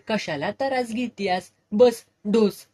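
sarcastic loud order; mocking shout. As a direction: needle her, then bark sit and drink.

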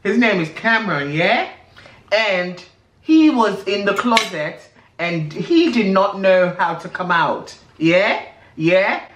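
a light mock British accent, ending lines on yeah?. Telling a friend about Big Brother UK in a mock British accent. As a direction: put on a cheeky UK accent, tag each line with yeah. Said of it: "[name], this accent is not".

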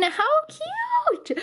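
very high squealing delight; excited squeal. Real, not acted. Admiring her sister's genie page, squealing.

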